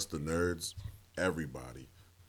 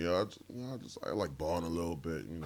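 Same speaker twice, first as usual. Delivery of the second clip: bashful admission; 2 semitones lower, 9 dB quieter, faster than usual. Acted. Nerd shyly admitting to a girl.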